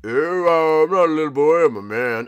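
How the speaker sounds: loud, insistent fake-manly voice. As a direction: insist you're a grown man. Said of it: "put on my manly voice".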